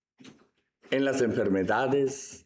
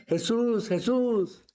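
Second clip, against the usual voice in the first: mock shout, pitched way up; imitated cry for help; 9 semitones higher than usual. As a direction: call out like the blind beggar. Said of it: "empezó a gritar".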